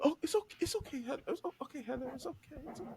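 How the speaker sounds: high, soft hammed-up comforting; mock soothing boyfriend. Boyfriend consoles the girlfriend who cheated, mock tender cooing. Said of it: "Rick be like".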